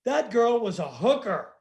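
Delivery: slow, deliberate, matter-of-fact; slow deadpan quote. Retold or imitated. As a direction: spell it out slowly to the whole lift. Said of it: "something along the lines of".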